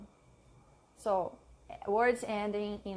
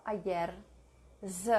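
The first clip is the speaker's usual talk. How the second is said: ordinary talk, nothing marked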